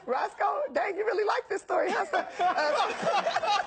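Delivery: high laughing cry; laughing hard at the son. Real, not acted. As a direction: crack up mid-word. Laughing at interviewer's amusement.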